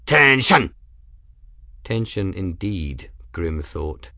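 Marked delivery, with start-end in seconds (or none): 0.0-0.7 loud barked command; snarled command. A narrator's reading. Kettle calling the men to attention, loud snarl. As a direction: bark it at the men. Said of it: "Kettle snarled".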